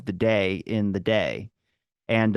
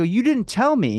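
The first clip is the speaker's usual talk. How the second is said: much higher, louder retort; exasperated protest; 11 semitones higher, 3 dB louder than usual. Retold or imitated. Friend arguing over breakfast plans, exasperated higher protest. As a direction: hit it like a fed-up friend. Retold argument with buddy.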